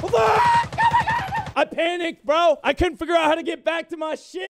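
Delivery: high, loud scream into frantic complaint; panicked yelling. Real, not acted. Player who fumbled getting back to cover, panicked yelling. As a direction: yell it high in a real panic.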